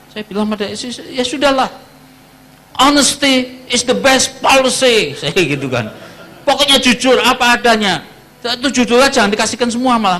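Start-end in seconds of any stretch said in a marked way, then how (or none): 1.2-5.1 higher, louder, slow proclamation to his wife; resigned declaration. Retold or imitated. Husband tells wife honesty is best, slow and loud. Telling wife about the bill. 6.4-10.2 higher and louder, laying down the rule; firm instruction. Retold or imitated. Husband instructs wife before the doctor visit, firm and loud.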